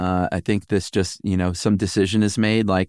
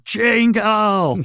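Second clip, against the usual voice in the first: high, drawn-out mock yell; comic high-pitched holler; mock scream; 13 semitones higher than usual. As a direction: cry it out like a crowd, pitched way up. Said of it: "Everyone screaming".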